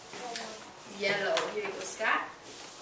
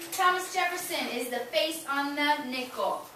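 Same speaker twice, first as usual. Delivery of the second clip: slow, emphatic restating; slow and clear; 5 dB quieter than usual. Acted. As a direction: spell it out slowly for kids. Teacher restates answer slowly.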